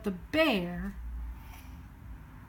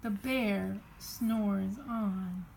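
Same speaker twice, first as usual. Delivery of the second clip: slow sleepy refrain; 4 semitones lower, 6 dB quieter, slower than usual. A narrator's reading. Slowed refrain.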